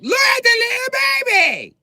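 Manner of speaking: put-on very high, loud little girl shriek. A little girl seeing a baby, shrieking high. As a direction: squeal it like an excited child. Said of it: "five-year-old British girl ... just like".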